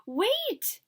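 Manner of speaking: high squeaky little mouse cry. A narrator's reading. Mouse warns Ganesha not to eat it, squeaky. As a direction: squeak it out, tiny and urgent. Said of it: "squeaked Mister Mouse".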